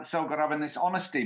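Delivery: usual speaking voice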